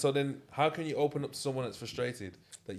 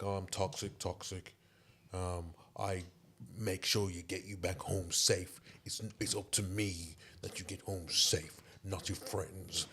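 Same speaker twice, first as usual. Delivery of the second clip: lightly voiced toxic man, low and slow; toxic boyfriend; 5 semitones lower, 7 dB quieter, slower than usual. Quoting a man from a video, low slow mock-toxic voice. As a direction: imitate a possessive man, quieter and drawn out. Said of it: "where he's talking like".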